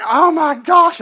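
very high, very loud exclamation; hammed-up excited shriek; shrieked recognition. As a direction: squeal it like spotting someone unexpectedly. Acted woman's outburst on seeing him.

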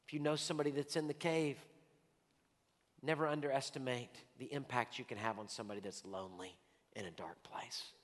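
soft, low, half-whispered invitation; hushed altar call. Acted. Pastor's closing invitation to the congregation, hushed and soft. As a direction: draw them close with a hush.

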